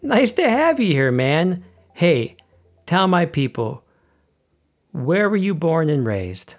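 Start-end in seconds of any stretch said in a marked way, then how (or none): none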